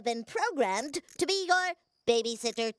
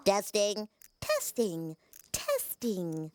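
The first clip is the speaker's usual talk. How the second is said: bedtime storytelling voice. Acted. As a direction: read it like a gentle bedtime story.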